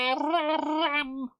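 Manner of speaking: dramatic muffled haram; muffled through hands. Acted. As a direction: say haram with hands over mouth. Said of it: "How the heck do you do that?".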